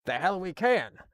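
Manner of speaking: a touch of angry captain; angry retort. Acted. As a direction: snap back at the detective, mildly. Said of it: "the captain replied in anger".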